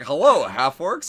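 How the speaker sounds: high, loud, drawn-out mock greeting; hearty greeting. Character walking up to tavern half orcs, hammed-up hearty greeting. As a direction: hail them slowly and loudly, playing the character.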